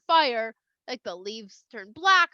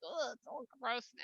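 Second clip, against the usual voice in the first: soft, squeamish grumble; grossed out; 12 dB quieter than usual. Retold or imitated. Moshe putting his diseased hand back, grossed out. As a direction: say it quietly with disgust.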